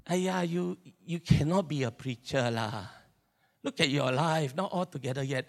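scoffing Singlish inner critic. Acted. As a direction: put yourself down in Singlish. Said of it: "My voice of my insecurity will come out".

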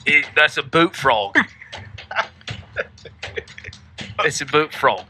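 put-on hammy Australian accent. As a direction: do a rough Aussie impression. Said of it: "do it in your Australian accent".

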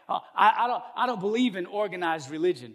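hammed-up reluctant protest; mock objection. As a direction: imitate a flustered skeptic protesting. Imitates reluctant churchgoer's excuse.

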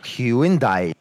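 Hyundai as HYU-in-DYE, drawn out in three parts. Shown as a demonstration. Caller plays his version to the helper, Hyundai stretched to hyu-in-dye. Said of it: "so it's pronounced".

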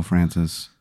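hushed, hesitant, low aside; quiet, halting appeal to a clerk; confidential lean-in. Acted. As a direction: lean in and speak softly, just between two. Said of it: "I kinda lean in".

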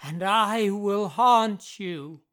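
loud, slow, drawn-out menace; threatening. A narrator's reading. Jeff vows to haunt the Irvings, slow and loud. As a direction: draw out the threat loudly. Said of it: "he threatened".

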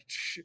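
a quick unvoiced shh to hush him; a secretive shush to keep it quiet. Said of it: "Like, I'm trying to tell him".

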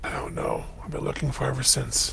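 hushed whispered admission. Acted. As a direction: whisper it, barely voiced. Quiet admission about Esmeralda.

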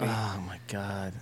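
breathless whisper, slow; real hushed oh my God. Real, not acted. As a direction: whisper it in disbelief. Reacting to testosterone movie.

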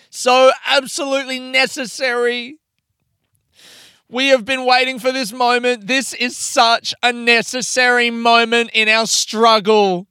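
high, loud declamation; mock rousing speech. Speaker hailing the day as a great moment, mock-grand oratory. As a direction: proclaim it like a hammed-up orator rallying followers.

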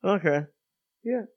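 talking around a pen in the mouth; words muffled by a pen between teeth. Real, not acted. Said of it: "You've got a pen in your mouth".

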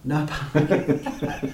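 slow, laughing repeat. Real, not acted. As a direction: laugh through the words.

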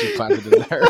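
laughing. Real, not acted. Laughing at the flubs.

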